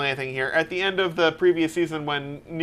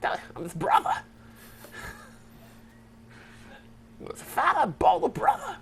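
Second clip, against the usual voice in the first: Christopher Walken impression; 12 semitones higher, 8 dB quieter than usual. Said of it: "Christopher Walken".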